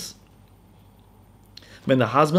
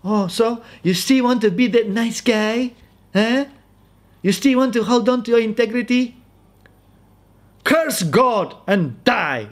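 high-pitched taunting wife, drawn-out words; mock a husband in a high, slow voice; scornful wife; 8 semitones higher than usual. Wife taunts suffering husband for holding on, scornful. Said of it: "now the wife comes and said".

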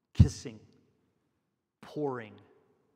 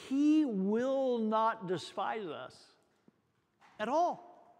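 he will not despise us, slow and rising high on "at all"; a slow, soaring promise of acceptance; stress on NOT DESPISE; 7 semitones higher, slower than usual. Real, not acted. Preacher stresses assurance.

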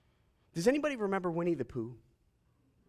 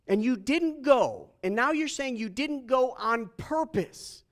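high-pitched, raised protest; indignant accusation; 6 semitones higher, 4 dB louder than usual. Acted. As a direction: press the charge in disbelief. Acted confrontation of Jesus.

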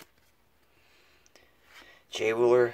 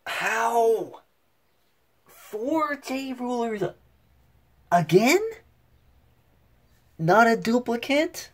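much higher and louder, stunned at the luck; amazed; 14 semitones higher, 8 dB louder, slower than usual. Real, not acted. Collector reacting to a fourth rare card, in high amazed disbelief. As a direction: shriek of real disbelief, stretched out.

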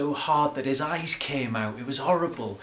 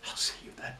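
whispering; 9 dB quieter, much faster than usual. Acted. Whispered goodbye.

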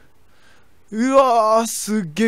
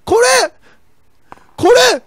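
very high, very loud screams; frantic yelling; 11 dB louder, much slower than usual. Real, not acted. Streamer screaming at a zombie attack, very high and loud. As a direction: shriek at the screen in panic.

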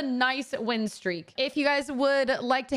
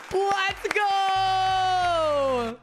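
celebrating; 8 semitones higher than usual. Real, not acted. Celebrates getting the skin.

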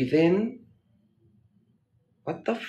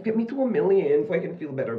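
ordinary talk, nothing marked

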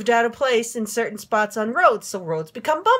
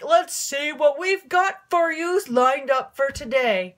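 mechanic's voice; 3 semitones higher, slower than usual. Retold or imitated. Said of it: "he's like".

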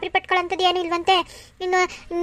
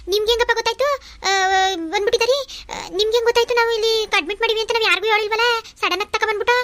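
higher-pitched, hurried surprise; startled questioning; 6 semitones higher, faster than usual. Acted. Woman asking the in-law how he found out, startled questioning. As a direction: question the unexpected visitor, pitch jumping up. Caught off guard by visiting in-law.

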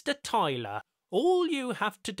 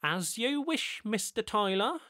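sighed resigned; 10 semitones higher than usual. A narrator's reading. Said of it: "sighed Ricardo, resigned".

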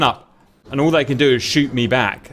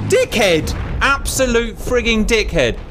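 exasperated; 8 semitones higher, slower than usual. Real, not acted. Pilot flew too high, insults.

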